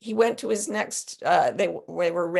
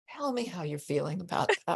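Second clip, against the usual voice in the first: softer, gentle negotiator question; calm soothing voice; 6 dB quieter than usual. Retold or imitated. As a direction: ask it softly and calmly. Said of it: "he'd be like".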